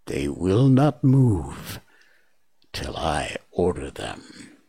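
slow, low, drawn-out snake hiss. Acted. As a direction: let Kaa hiss each word slowly and menacingly. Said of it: "Kaa hissed".

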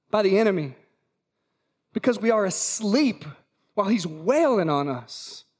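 usual speaking voice

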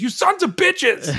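high, drawn-out mock-furious cry; mock outraged outburst. Imagining friend turning on his podcast hosts, mock outrage. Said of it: "he turns around and goes".

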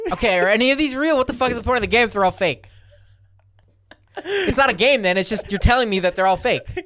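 loud, high-pitched comic outrage; worked-up, incredulous complaint; exasperated protest. Real, not acted. Fed up with rigged game.

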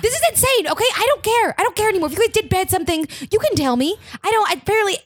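high, exasperated rant, speeding up; a little hysterical. Acted. Mom fed up with the family's insane act, hysterical. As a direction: protest in rising frustration. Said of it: "You're a little hysterical".

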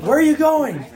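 loud surprised call. Retold or imitated. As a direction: shout after a friend running off. Calling after running friend.

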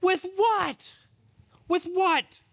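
exasperated protest. Acted. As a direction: plead that it is beyond you. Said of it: "what you're gonna say".

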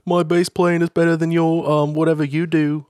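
hammed-up Liverpool impression, faltering with a laugh. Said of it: "play the part of one of the other Beatles".

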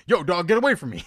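mock tough warning to a bear; serious warning. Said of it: "he puts, like, bass in his voice".

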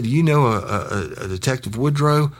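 high, stammering imitation of distress; mock tearful caller. Crying kid asking about the detective, mock tearful. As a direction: ask haltingly in a put-on upset voice. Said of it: "He was cr-, crying".

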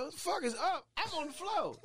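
mock outrage. Retells friends finding him drunk.

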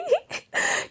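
squeaky breathy giggle; high giggle. Real, not acted. Laugh after mock outrage.

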